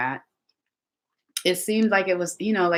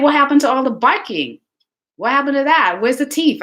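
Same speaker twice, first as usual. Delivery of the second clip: indignant disbelief; 9 semitones higher, 5 dB louder than usual. Retold or imitated. Indignant quote at sitting dog.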